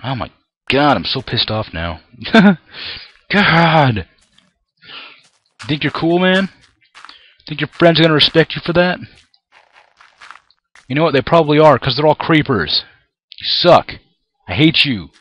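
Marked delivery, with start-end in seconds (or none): 2.2-3.0 high loud burst of laughter; exasperated laugh. Real, not acted. After the creeper blows up, a loud high laugh. 3.3-4.1 high loud frustrated yell; exasperated cry. Real, not acted. After losing his land, a loud high angry God. 5.7-15.1 angry taunt. Real, not acted. Taunting the creeper angrily.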